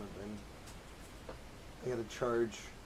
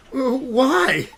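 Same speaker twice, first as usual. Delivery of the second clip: panicked; 9 semitones higher, 6 dB louder than usual. Acted. Aliens appear suddenly.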